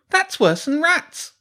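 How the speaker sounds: very high, loud, slow boom; booming Hugo. Acted. Hugo butting into the friends' talk, booming. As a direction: boom it out like a loud know-it-all kid. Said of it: "Hugo boomed".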